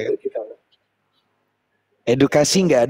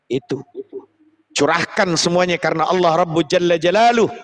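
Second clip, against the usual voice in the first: ordinary delivery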